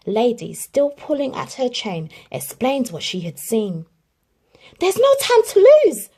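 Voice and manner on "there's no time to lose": high, loud urgent bark. A narrator's reading. Tramp urges Lady to hurry, loud. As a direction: snap it out urgently. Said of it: "barked Tramp".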